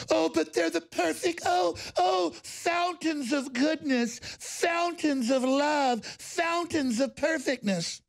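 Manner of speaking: high, very slow sugary parody voice; sarcastic mockery. Commentator mocking claims of goodness in a high, slow sarcastic voice. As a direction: drip with mock sweetness, stretching every fountains.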